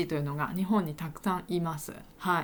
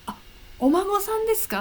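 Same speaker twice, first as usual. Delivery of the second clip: bright high surprised reply, louder; 12 semitones higher, 5 dB louder than usual. Retold or imitated. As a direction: act amazed at the grandchild. (驚いて高い声で)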